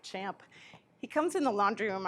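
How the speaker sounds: usual speaking voice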